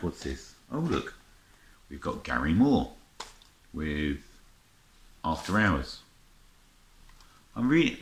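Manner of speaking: ordinary talk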